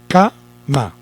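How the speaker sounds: CA-MA in two separate beats; "cama" broken slowly into syllables; split into syllables. Shown as a demonstration. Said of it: "separamos por sílabas".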